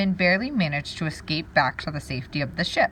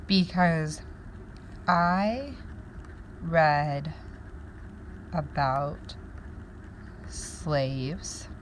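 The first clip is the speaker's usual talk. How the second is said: slow think-aloud; 6 dB quieter, much slower than usual. Shown as a demonstration. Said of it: "So that's a connection".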